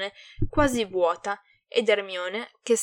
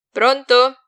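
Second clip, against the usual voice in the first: loud, higher-pitched hello; loud, raised greeting; loud phone answer; 4 semitones higher, 14 dB louder than usual. A narrator's reading. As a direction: pick up with a forceful question. Answers the ringing phone again.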